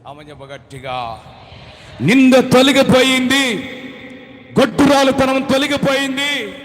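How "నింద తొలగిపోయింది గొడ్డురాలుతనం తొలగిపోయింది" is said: triumphant preaching. Real, not acted. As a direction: proclaim the victory. Preacher proclaims victory.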